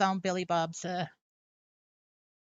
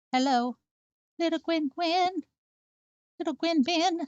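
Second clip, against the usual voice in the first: pet talk; 9 semitones higher, 3 dB louder than usual. Real, not acted. Owner greets her little cat Gwen in cooing baby talk. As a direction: coo it up high to the kitten.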